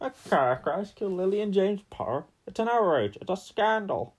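Hagrid impression. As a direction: announce the outrage like an old radio man. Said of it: "That was getting better".

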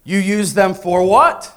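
slow, loud, angry father; angry rising volume. Retold or imitated. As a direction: let the anger swell in volume. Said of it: "increasing, like, in volume with the anger".

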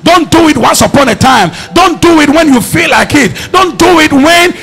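preaching at full force. Acted. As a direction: rise to a preacher's climax on continually. Preacher builds a loud list.

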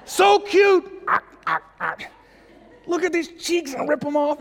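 very high, overcome-by-cuteness squeal; doting dad gushing. Acted. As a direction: squeal like you can't resist those cheeks. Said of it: "we know this feeling".

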